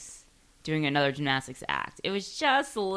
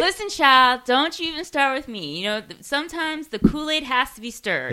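sassy woman voice; 8 semitones higher than usual. Pretend. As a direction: play the sassy aunt who scolds. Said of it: "whenever I say".

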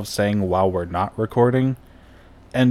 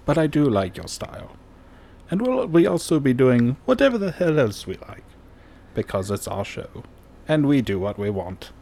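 put-on podcast intro in an old-timey British accent; hosting in a jaunty old-fashioned British accent; 2 semitones higher than usual. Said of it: "I was trying to keep it, like, old-timey British".

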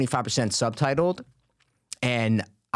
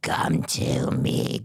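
very low, slow, whispered demon voice; exorcist voice; 12 semitones lower, much slower than usual. Shown as a demonstration. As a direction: breathe it out like a possessing demon, very slow. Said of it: "Do an exorcist voice".

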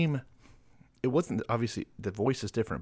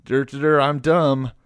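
loud, raised dopey voice; mock dumb voice; 4 semitones higher, 11 dB louder, slower than usual. Mocking a Polish joint owner, loud dopey voice. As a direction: play it as a loud, hammed-up dimwit. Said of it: "Are you gonna be like".

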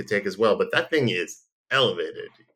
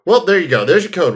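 excited; 9 semitones higher, 9 dB louder than usual. Real, not acted. Announcing code word.

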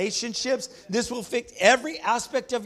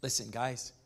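softer, earnest pulpit voice; hushed preaching; 8 semitones lower, 8 dB quieter than usual. Acted. Pastor warns the church about doubt, hushed and earnest. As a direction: draw them in with a low, earnest hush. Quiet intimate stretch of the sermon.

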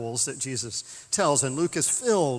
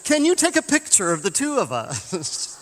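clueless American tourist; 7 semitones higher, 6 dB louder than usual. Retold or imitated. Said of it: "and says".

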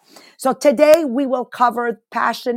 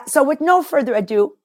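ordinary talk

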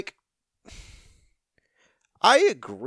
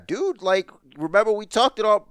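normal talking voice

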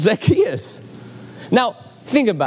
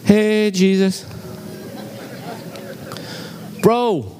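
awkward caught-out greeting; 3 semitones higher, slower than usual. Retold or imitated. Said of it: "It's like".

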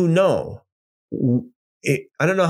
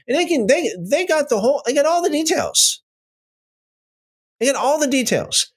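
much higher, insisting they have every detail; emphatic excitement; 12 semitones higher than usual. Real, not acted. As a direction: push it up with conviction. Contrasting Ethiopian account.

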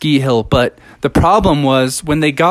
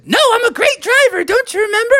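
put-on much higher, fast bratty kid voice; little bear cub; faster than usual. Junior Bear refuses to push the car, high cheeky cub. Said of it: "He said".

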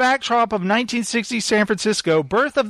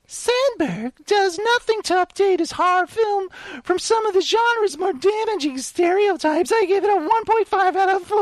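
much higher mock British woman; a hammed-up British lady critic; British female voice; 12 semitones higher than usual. Reading a female critic's review in a high mock British accent. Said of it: "my female voice has to be like a British accent".

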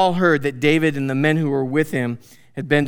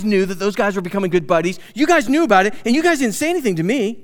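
higher and louder accusation; accusing Saul; 5 semitones higher, 4 dB louder, faster than usual. Retold or imitated. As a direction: voice Saul lashing out at his men. Preacher voices paranoid Saul.

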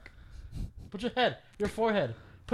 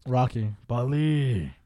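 Rocky-style imitation; 4 semitones lower, much slower than usual. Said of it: "I always thought it was, like, Rocky".

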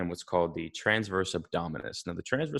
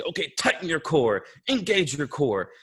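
coaching cue; 6 semitones higher than usual. Retold or imitated. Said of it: "people brace and they say".

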